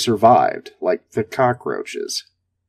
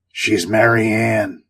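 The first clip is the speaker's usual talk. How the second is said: slow, gravel-rough commander's voice; much slower than usual. Acted. Station commander teasing the crew over the radio, gravel-rough and slow. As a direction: growl it out slowly like a gruff old commander. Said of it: "the gravel-rough voice of Gerard Delphi".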